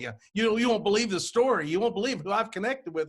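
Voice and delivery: excited retelling. Retold or imitated. Telling everybody in the business the story, excitedly. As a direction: act out bursting to share news.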